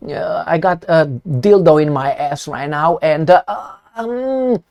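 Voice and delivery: lightly voiced cowering spokesman; mock timid official. Mocking the weak official answer, soft and hesitant. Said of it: "Instead of".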